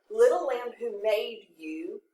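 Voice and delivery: slowed, plainer modern wording; slow paraphrase. Shown as a demonstration. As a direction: read slowly to make it easier. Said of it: "that might make it a little bit easier".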